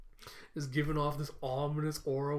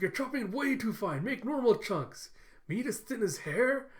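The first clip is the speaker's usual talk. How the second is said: higher-pitched acted scolding; mock exasperation; 7 semitones higher than usual. Camilla corrects Yor's chopping, exasperated. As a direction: play an exasperated cooking critic.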